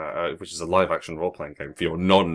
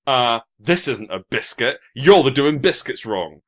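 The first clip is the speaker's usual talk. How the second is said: slow, loud mock protest; hammed-up slow indignation; mock indignant American; 4 semitones higher, 7 dB louder, slower than usual. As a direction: play an outraged online pedant, drawn out. Imitating indignant Americans online.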